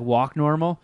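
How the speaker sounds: ordinary delivery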